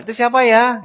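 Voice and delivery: high, loud questioning call; voicing the host. Host calls out to a visitor, high and loud. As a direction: call through the door. Caller asks through the door.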